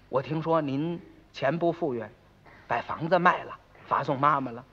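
low, hushed neighbour's inquiry; tactful probing. Acted. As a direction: broach a delicate subject softly. 街坊小心打听卖房的事. (压低声音小心打听)

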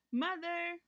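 put-on high child's call; shout. Boy at the door summoning his mother, shouting high. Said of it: "Richard shouted".

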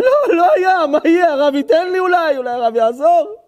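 high, loud begging of the rabbi; desperate plea. Acted. Poor man begs the rabbi. (תחינה נואשת בקול גבוה)

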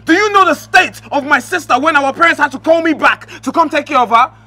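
high, loud scolding tirade; angry rant. Acted. Brother rants at the husband for insulting his sister, angry and loud. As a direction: tear into him without letting up.

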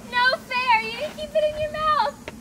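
loud, high and indignant, slowed; loud protest. Real, not acted. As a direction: yell it, outraged at the cheat. Protesting the spilled sauce.

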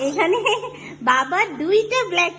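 real laughter while showing the two shirts; laughing. Real, not acted. Mother laughing explaining to toddler.

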